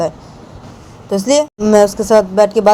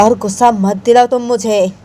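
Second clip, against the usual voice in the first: raised, insistent acted warning; heated, higher-pitched threat; angry warning; 4 semitones higher than usual. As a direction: warn them with mounting temper. Warning repeated more angrily.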